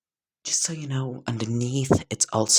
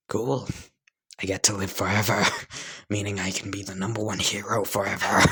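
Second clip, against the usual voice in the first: gleeful excitement; faster than usual. Acted. Bakugo learning he gets to live forever, gleeful. Bakugo delighted by new threat.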